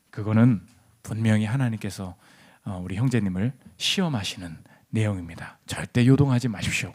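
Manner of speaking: low, soft, measured pastor's voice; acted calm pastoral counsel. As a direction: play the unruffled pastor, low and hushed. Unworldly pastor counsels the upset man.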